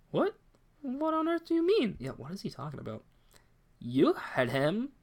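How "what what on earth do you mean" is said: much higher, startled question; shocked high girl voice. Acted. Girl shocked by the father's blame, high and startled. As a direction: gasp it up high in disbelief. Voicing a female character shocked.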